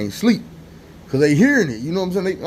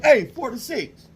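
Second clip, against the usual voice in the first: much higher, slow call across the tier; 12 semitones higher, slower than usual. Retold or imitated. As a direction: call his number from far below. Nurse calls cell number from door.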